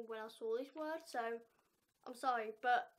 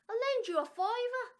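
high, loud chirpy English offer; English speaker's offer; 8 semitones higher, 8 dB louder than usual. Acted. The English friend offers a fiver, high and loud. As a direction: pitch it up as the eager English friend. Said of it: "So, English, Irish thing".

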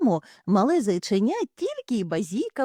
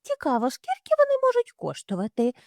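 much higher, curious; wondering mother hare; faster than usual. Acted. Mother hare wonders about free kittens, high. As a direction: wonder aloud innocently.

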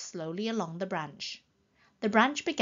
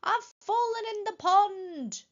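high cry, slowing as it goes; calling for help; 5 dB louder, much slower than usual. A narrator's reading. Hidden voice claims it fell in the pond, calling for help. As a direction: call out high and stretch the words. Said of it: "called the voice".

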